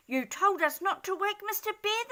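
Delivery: high quick small voices; rabbits replying. Acted. Rabbits answering the angry dormouse, high. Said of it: "they replied".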